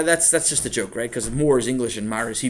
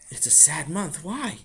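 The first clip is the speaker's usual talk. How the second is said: puzzled father; 4 semitones higher, slower than usual. Acted. Father questions Sammy's claim, puzzled. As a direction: repeat it back, then ask, voice lifting.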